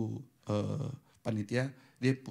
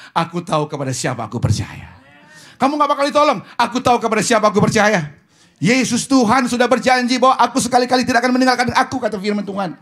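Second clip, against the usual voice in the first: very loud, building to high shouted declaration; preaching at full force; 11 semitones higher, 17 dB louder, faster than usual. Real, not acted. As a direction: proclaim it to the whole congregation at a shout. (khotbah berapi-api, berseru lantang)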